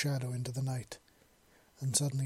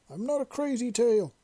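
high, loud grumbling from another room; muffled, grumpy protest; muffled grumble; 10 semitones higher, 5 dB louder than usual. A narrator's reading. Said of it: "muffled voice grumble".

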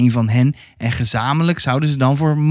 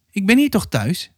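put-on higher-pitched child's quick assurance; 7 semitones higher than usual. A narrator's reading. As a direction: assure them promptly, voice lifted. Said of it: "verzekerde Momo vlug".

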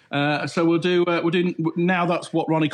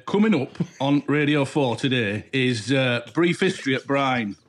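put-on lower, slow continuity read in a light Yorkshire accent; serious Yorkshire announcement; 4 semitones lower than usual. DJ reading a mock trailer in a light Yorkshire accent. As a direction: announce it gravely, Yorkshire style. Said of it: "something really serious in a Yorkshire accent".

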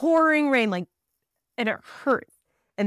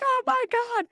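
much higher frantic oh my God; panicked exclamation. Retold or imitated. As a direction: gasp it out caught in the storm. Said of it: "We're like".

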